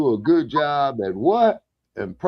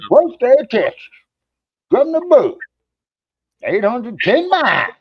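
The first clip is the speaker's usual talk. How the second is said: exclaiming; 10 semitones higher, 6 dB louder than usual. Real, not acted. Complaining about crossing Texas.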